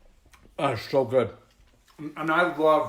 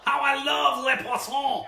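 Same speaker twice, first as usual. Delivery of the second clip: lightly sung-out French chef line, higher; movie chef quote; 8 semitones higher, 3 dB louder than usual. Retold or imitated. Host quoting a movie chef to viewers, playful high voice. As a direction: ham up a cartoon French chef. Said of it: "What is that movie from?".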